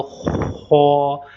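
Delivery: खौ rasped heavy from the back of the throat; thick throaty kh. Shown as a demonstration. Teacher demonstrating a thick throaty खौ to students. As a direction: clear your throat on खौ, thick and rough. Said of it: "इसको थोड़ा मोटा करना है".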